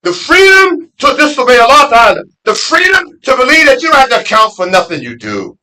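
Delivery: loud, high rising sermon; full-force preaching. Acted. Preacher rejecting Western freedom to the congregation, loud full-force preaching.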